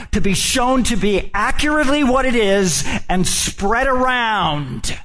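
lifted, emphatic pulpit delivery; preaching. Acted. Preacher builds to the point.

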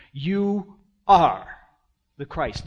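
YOU and ARE each set apart, loud; stress on ARE. Acted. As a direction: declare it firmly, hitting ARE hard. Repeats Peter's answer emphatically.

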